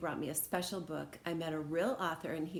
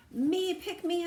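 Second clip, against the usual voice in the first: very high, loud begging; a kid begging the teacher to pick her; eager shout; 12 semitones higher, 8 dB louder, slower than usual. Acted. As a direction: shout to be picked. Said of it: "I shouted".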